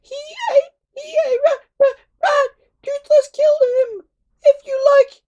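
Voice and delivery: very high, loud, whiny little dragon shouting; a squeaky dragon crying out in protest; complaining Toothless. A narrator's reading. Toothless offers to kill Alvin.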